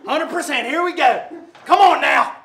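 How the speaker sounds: loud, high rallying shout; urging coach. Real, not acted. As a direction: push the athlete on at full volume. Coach urging full effort.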